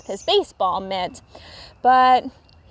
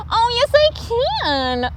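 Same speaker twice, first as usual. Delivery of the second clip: high little raccoon insisting; eager cry; 12 semitones higher than usual. A narrator's reading. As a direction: insist brightly like a small child. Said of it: "cried Little Raccoon".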